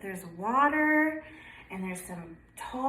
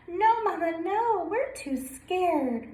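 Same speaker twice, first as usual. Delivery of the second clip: scared ducklings crying; 7 semitones higher, slower than usual. Acted. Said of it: "cried Piddle".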